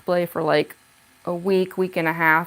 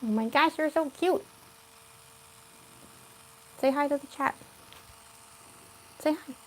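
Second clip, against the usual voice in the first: talking to a cat; 6 semitones higher, 7 dB quieter than usual. Real, not acted. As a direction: coax the cat to say hi, quietly and high. Urging the cat to greet chat.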